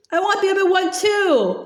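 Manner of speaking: understated sobbing wail; loud sobbing. A narrator's reading. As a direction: lightly sob out the words. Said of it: "Laura sobbed loudly".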